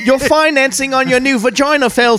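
high, loud put-on Australian repo man; mock Australian accent. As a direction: play a cheeky Aussie repo man. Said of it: "Yours is very Australian".

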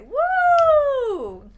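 a high, loud lashing-out shriek; imitating herself lashing out with a loud high shriek; shrill mock shriek. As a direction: let out a loud high yelp like a fight. Lashing out at ex-boyfriends.

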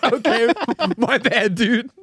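high, laughing through an awkward sorry; laughing apology. Retold or imitated. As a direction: giggle out an embarrassed my bad. Retold own reply, laughing.

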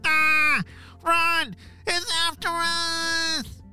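high panicked shout, slow at the end; panicked scream. Acted. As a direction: scream and run from a monster. Ninjas flee the monster.

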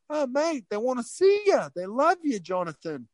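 high-pitched, excited Australian bloke; broad Australian impression, high and eager; Australian accent. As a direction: imitate a gushing Aussie, pitched well up. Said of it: "I'll stop with the accent".

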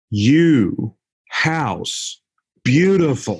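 one word at a time, swooping high with long pauses; slow, loud English. Retold or imitated. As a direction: spell it out slowly for someone who doesn't understand. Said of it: "how slowly he said it, how loudly".

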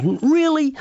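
high-pitched, drawn-out incredulous really; retold surprise. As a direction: mimic someone caught by surprise, pitch leaping up. Imitates the professor's surprised reply.

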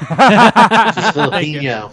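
loud laughing drawl. Pretend. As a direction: drag it out through a laugh. Mocking pronunciation, laughing.

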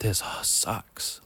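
slow, whispered complaint; slow whispered gripe. Retold or imitated. Homesick recruit in his bunk, slow defeated whisper. Alone at night, texting.

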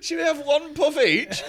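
high, loud mocking voice through laughter; mocking stoners. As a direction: send up the cheap stoners, laughing. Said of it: "they've gone".

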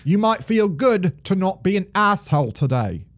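hammed-up Aussie letter reading; amateur mock Australian accent. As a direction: sign off the note in a broad put-on Australian accent. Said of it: "that's probably the best my Australian accent has sounded".

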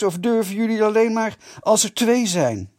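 high, loud taunting call. A narrator's reading. Kees dares the Vitessers to come out, calling loudly. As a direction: shout a dare at the door. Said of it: "riep Kees".

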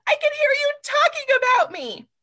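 put-on high, loud teenage yell; teen screaming. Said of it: "coming out and screaming".

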